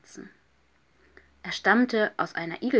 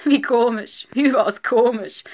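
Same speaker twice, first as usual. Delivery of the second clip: loud, higher hare voice; exclaiming hare; 4 semitones higher, 9 dB louder than usual. A narrator's reading. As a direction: call it out as the baffled hare. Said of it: "rief der Hase". (laut rufender, verwunderter Hase)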